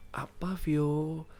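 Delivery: soft, slow just-woken grandpa; drowsy grandfather. Acted. Opa woken by Vio before dawn, drowsy and soft. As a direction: answer quietly, half asleep.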